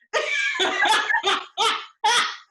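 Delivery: squealing laughter; high laugh. Real, not acted. Laughing at show.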